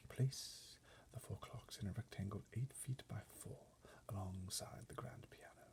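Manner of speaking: unvoiced butler whisper. A narrator's reading. Butler directing footmen in a whisper. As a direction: murmur it under your breath. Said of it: "Mister Tibbs whispered".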